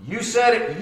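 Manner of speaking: loud, high you said it; emphatic declaration. Shown as a demonstration. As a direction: throw it out with emphasis. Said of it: "that word would have been this".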